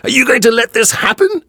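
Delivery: high, loud, slowed outcry; young man shouting at the onlookers. A narrator's reading. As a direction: rouse the crowd with a desperate shout. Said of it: "he shouts at the people".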